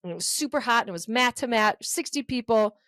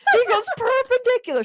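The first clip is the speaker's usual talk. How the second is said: high, loud outburst; exclaimed; 12 semitones higher, 5 dB louder than usual. Real, not acted. Telling a friend about the yoga class, exclaiming. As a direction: burst out with disbelief. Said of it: "retelling outrage, laughing".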